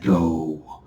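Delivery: low, slow demonic voice; slow low demon command. Pretend. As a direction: growl it like a movie demon. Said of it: "a little demon's flying around and it's like".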